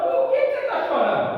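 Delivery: high, loud, startled question to her husband; alarmed cry. Acted. Wife cries out to her crying husband, high and loud.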